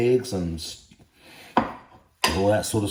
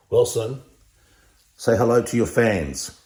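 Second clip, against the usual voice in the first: ordinary talk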